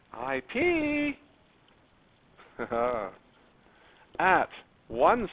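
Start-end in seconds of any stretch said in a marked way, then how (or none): 0.1-1.1 very high-pitched silly cry; playful squeal. Pretend. Teacher joking about the inflection point's short name, playful squeal. Jokey repeat of abbreviation, then laughs. 2.6-3.1 short chuckle. Real, not acted. Teacher amused after a joke to the class, short chuckle. Laughs at own silly delivery.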